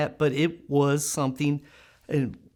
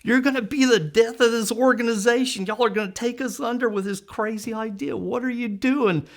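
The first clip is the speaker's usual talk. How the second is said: raised, higher mock shout; imitating a staffer yelling from a chair; half-hearted retold rant; 7 semitones higher, 4 dB louder than usual. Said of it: "started just shouting".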